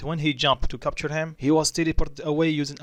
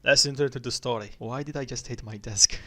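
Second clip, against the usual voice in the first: usual speaking voice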